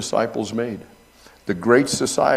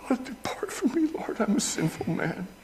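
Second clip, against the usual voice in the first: hushed, pleading whisper on his knees; Peter's broken plea; 6 dB quieter than usual. Acted. Peter on his knees before Jesus, hushed plea. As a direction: beg softly as a sinful man. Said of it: "Jesus fell down on his knees".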